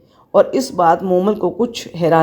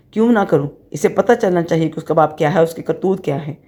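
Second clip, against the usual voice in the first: usual speaking voice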